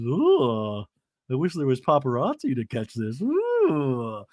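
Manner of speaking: a hint of relishing the drama; lightly voiced smug Heather. Podcaster imitates Heather savoring the fight beside Emily. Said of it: "Heather Dubrow ... going".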